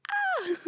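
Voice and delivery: very high excited shriek; a sudden high squeal of glee. Real, not acted. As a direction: squeal with delight. Excited about new friendship.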